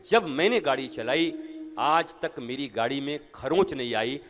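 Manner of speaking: usual speaking voice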